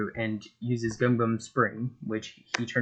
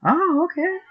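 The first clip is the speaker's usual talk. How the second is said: squeaky high; 4 dB louder than usual. Acted. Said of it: "Buggy's like".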